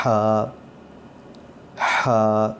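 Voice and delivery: ہا breathed from the middle of the throat with pressure. Shown as a demonstration. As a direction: push air from the throat for ہا. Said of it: "درمیان حلق سے اس کی آواز نکلے گی". (حلق سے دباؤ کے ساتھ ہا)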